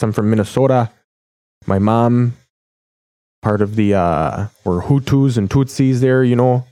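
imitated Minnesota hockey-player accent. Host mimicking hockey players from Minnesota, light hockey accent. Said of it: "They all talk like this".

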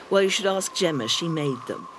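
ordinary talk

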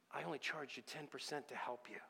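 soft, near-whispered retelling; hushed reveal. Retold or imitated. Contractor tells client he undercharged him, hushed reveal. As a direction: drop to a hush for the quiet truth. Quiet reveal in retold confrontation.

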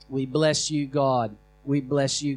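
ordinary delivery

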